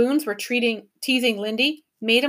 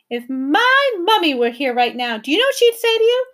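defiant; 7 semitones higher, 5 dB louder than usual. A narrator's reading. Girl challenging the mob.

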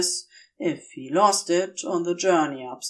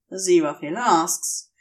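ordinary delivery, nothing marked

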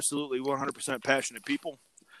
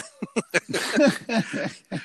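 bursts out laughing high; a real laugh breaking out; 9 semitones higher than usual. Real, not acted. Laughing at the story.

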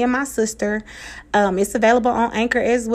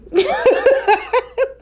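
high squealing laugh. Real, not acted. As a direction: burst into a giggle. Laughing at own kiss-off.

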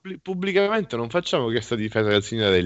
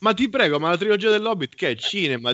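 high, exasperated rant; mock outburst of anger; 7 semitones higher than usual. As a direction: play up a fit of rage. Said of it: "posso anche permettermi uno scatto di ira".

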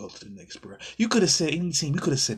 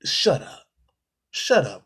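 sharp shut up; 4 semitones higher, 7 dB louder, slower than usual. Real, not acted. Exasperated at the player's trade wish.

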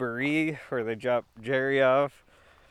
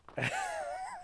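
squeaky laugh; 10 dB quieter than usual. Real, not acted. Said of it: "I'll sound like Kermit".

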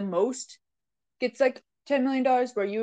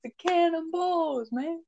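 country accent; 6 semitones higher than usual. Shown as a demonstration. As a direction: say it like a Southern country boy. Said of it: "Say it with a country accent".

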